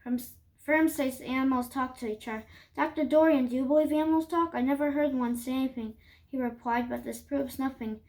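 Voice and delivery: usual speaking voice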